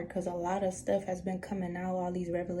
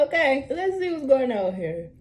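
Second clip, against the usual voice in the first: laughing; 5 semitones higher, 8 dB louder than usual. Real, not acted. Laughs after calming herself.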